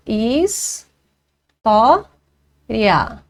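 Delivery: "Is, to, ria" com pausa entre as sílabas; divisão silábica. Shown as a demonstration. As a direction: separe "Is", "to" e mantenha "ria" junto. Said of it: "encontro de duas vogais na mesma sílaba". ("Is, to, ria" silabado, o ditongo numa só sílaba)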